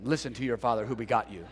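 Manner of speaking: low, fast, mock solemn; a lightly voiced stern biblical dad; mock stern King James father. Shown as a demonstration. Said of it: "what a horrible upbringing".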